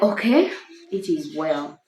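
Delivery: husband's soft, low resignation; hurt husband accepting quietly; deep man's voice. Acted. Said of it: "you know, deep".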